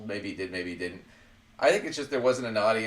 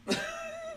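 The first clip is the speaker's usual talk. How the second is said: short high-pitched chuckle; soft, high laugh to oneself; amused laugh; 7 dB quieter than usual. Real, not acted. Host scoffing at a politician's hypocrisy, soft high laugh.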